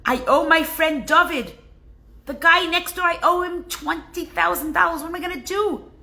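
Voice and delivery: fretting over the debt; a lightly voiced panicking Moshe; worried husband. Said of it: "He says".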